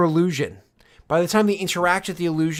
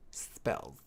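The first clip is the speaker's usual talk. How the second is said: whispered; 13 semitones lower, 15 dB quieter than usual. Real, not acted. Muttering to self.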